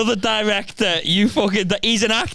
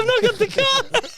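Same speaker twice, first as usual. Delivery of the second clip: the excuse again, much higher; high mock repeat. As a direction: squeak the excuse out in mockery. Mocking pub-goer's excuse repeated.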